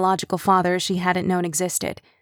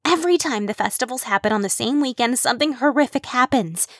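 higher, dramatic worried voice; fretful Kim voice; 5 semitones higher than usual. Acted. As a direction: sell the doom. Kim frets.

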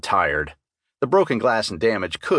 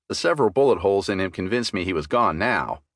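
plain reading voice

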